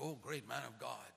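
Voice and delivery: ordinary talk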